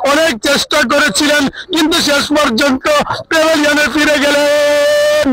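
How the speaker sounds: comic cricket commentary. Acted. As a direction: ham up the commentary for laughs. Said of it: "দর্শককে হাসিয়েছেন". (মজার ধারাভাষ্য)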